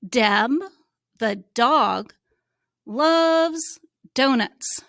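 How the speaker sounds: Deb, the dog, loves donuts read slowly, word by word. Shown as a demonstration. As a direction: model reading with pauses between words. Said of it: "Follow my cursor".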